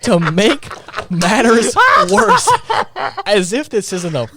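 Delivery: words breaking into laughter; laughing. Real, not acted. Ranting about the plot to her co-host, laughing hard.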